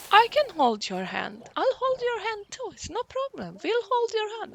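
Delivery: very high, put-on helpful voices; high-pitched mock eagerness; eager friends imitated. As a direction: mimic friends chirping their offers. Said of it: "They were like".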